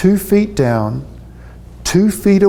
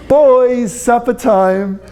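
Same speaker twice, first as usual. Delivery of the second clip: calling out; 8 semitones higher, much slower than usual. Acted. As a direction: sing out to the boys that dinner is ready. Calling the kids to dinner.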